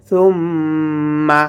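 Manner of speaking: slow "সুম্মা", its double m hummed long; ghunnah. Shown as a demonstration. Teacher showing the tashdid hum, long nasal m in "সুম্মা". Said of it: "গুন্না করে পড়তে হবে".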